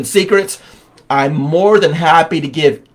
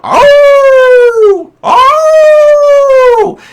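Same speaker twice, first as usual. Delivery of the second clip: mock dog howling, much higher and louder; 8 dB louder than usual. Farm dog howling in the background of the sales pitch, mock howl. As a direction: yelp out a hammed-up howl like a dog in pain. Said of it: "there's a dog howling in the background".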